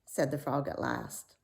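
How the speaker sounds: plain reading voice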